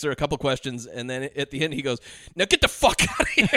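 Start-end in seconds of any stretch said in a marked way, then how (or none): 2.3-3.5 high, mock-angry dismissal; Mark Ruffalo. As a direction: bark it out as a cop, laughing. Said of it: "I'm just being him eternally".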